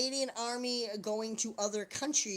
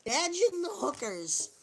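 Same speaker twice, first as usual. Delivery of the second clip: "Imagine" pushed up higher and loud, falling on "hookers"; higher repeat; 6 semitones higher, 6 dB louder than usual. Real, not acted.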